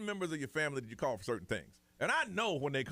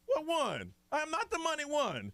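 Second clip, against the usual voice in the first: indignant protest; 13 semitones higher, slower than usual. Retold or imitated. Protesting friend's claim.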